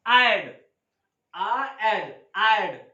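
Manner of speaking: ऐड as one merged "I'd"; I and D joined into ऐड; pronunciation demo. Shown as a demonstration. Teacher drills the pronunciation of I'd, contracted ऐड. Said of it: "इसकी pronunciation होती है".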